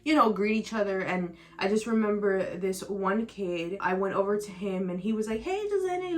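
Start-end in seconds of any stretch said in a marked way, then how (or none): 5.4-6.2 high, bright mock-excited hello; imitating a friend's eager greeting; super excited greeting. Boy greeting his sad friend, mock-excited and high. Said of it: "like, super excited".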